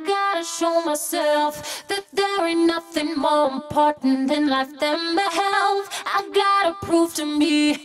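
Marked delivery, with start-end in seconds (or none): none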